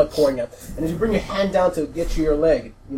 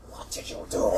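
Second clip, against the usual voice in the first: breathy, unvoiced, softer question; hushed accusation; 8 dB quieter than usual. Gretchen faces the blue orc, hushed accusation. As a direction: say it under your breath, aghast.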